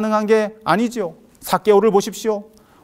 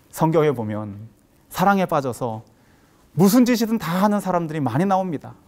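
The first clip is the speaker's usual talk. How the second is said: ordinary talk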